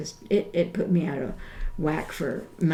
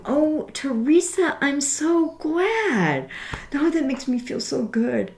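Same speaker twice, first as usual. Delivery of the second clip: delighted; 7 semitones higher than usual. Real, not acted. Caller praises her book.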